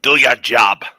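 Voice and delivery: clipped Cork-style Irish delivery of Do your job; Irish accent. Shown as a demonstration. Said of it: "can you say it in an Irish accent for us".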